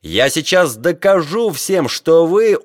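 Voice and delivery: high, loud, impassioned threat, cut short; heated. A narrator's reading. Geographer refusing to calm down before crew, heated. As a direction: press on heatedly until interrupted. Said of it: "Его горячность была не того сорта".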